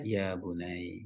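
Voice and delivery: soft, low and slow; gentle fatherly tone. Shown as a demonstration. Father advising his sick child, soft and slow. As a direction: say it tenderly, drawing it out quietly. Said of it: "dengan nada yang lembut".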